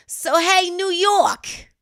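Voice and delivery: high, loud call to New York. Acted. Host hailing New York ahead of a joke, high and loud.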